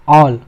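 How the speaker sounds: ऑल as a rounded "awl"; "o" blended into the vowel of ऑल; heavy rounded sound. Shown as a demonstration. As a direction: give ऑल a heavy "aw". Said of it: "थोड़ा heavy sound के साथ".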